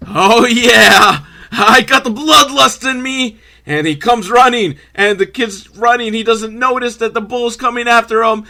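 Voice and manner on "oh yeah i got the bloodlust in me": a hammed-up menacing bull, higher and louder; bloodthirsty bull. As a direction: voice the bull relishing the chase. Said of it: "he's like".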